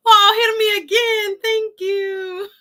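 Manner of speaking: high, loud, tearful-sounding squeal of thanks; overjoyed squeal. Real, not acted. Host squealing thanks at a viewer's donation, overjoyed. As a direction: squeal with delight at the gift.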